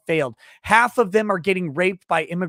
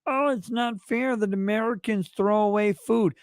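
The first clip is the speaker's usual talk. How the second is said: mocking whiner; 5 semitones higher than usual. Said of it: "They're like".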